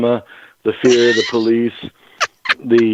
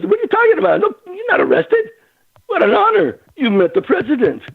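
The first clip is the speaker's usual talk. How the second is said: very high-pitched, incredulous exclaiming; amazed policeman. Retold or imitated. Policeman telling the suspect he's honored, high and amazed. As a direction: imitate an officer bubbling over in disbelief.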